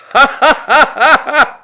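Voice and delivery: shrill, loud burst of laughter; real high laugh. Real, not acted. Laughing at the mortgage irony.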